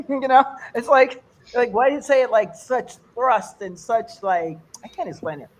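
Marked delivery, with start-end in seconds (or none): none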